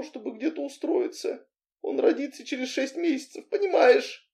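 high-pitched, softened desperate plea; tearful pleading. A narrator's reading. Pregnant heroine begging Anton for help, tearfully pleading. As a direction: beg him, voice high and near tears.

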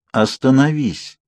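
slow, firm command; calm force. A narrator's reading. Alice insisting her speeding brother stop, firmly. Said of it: "в её голосе зазвучала сила".

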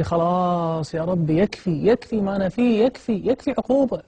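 begging God to stop, voice climbing high; anguished plea to God. Retold or imitated. Broken man begging his Lord to end the punishment, anguished. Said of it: "فقلت في نفسي".

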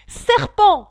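high, loud shriek of serpent; pigeon crying out. Acted. Pigeon screeching an accusation at Alice, shrill loud cry. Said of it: "criait le pigeon".

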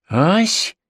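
put-on high rising old woman's query; granny caught off guard, puzzled; astonished. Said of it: "изумлённо спросила старушка".

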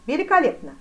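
loud, high cry; delighted exclamation. A narrator's reading. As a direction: exclaim it with force. (громко, восторженно)

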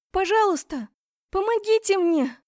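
put-on very high, loud little-girl plea; thin child's voice. A narrator's reading. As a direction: cry for help like a frightened child. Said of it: "тонкий детский голосок". (тонкий детский голосок, мольба о помощи)

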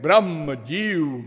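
ordinary talk

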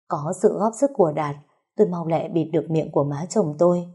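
plain reading voice

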